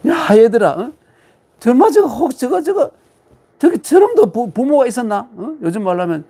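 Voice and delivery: put-on high, loud scoffing old master; mock Confucius griping behind a student's back; gossiping Confucius. Lecturer plays Confucius scoffing to disciples, high and loud. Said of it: "공자님이 막 뒤에서 씹어요".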